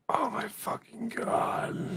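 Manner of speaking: exasperated whisper. Real, not acted. Exasperated aside.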